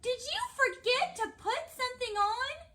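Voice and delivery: high, loud motherly question; high-pitched storybook mom calling. A narrator's reading. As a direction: call out to your child in a high voice. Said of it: "called his mother".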